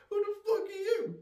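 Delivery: hammed-up shocked yelp; mock startled guy. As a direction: squeal it like a startled partygoer. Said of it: "He's just like".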